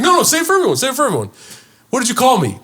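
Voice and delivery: high, insistent retold challenge; making a scene. Host making guest repeat it for everyone, confrontational. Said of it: "I made a whole fucking scene".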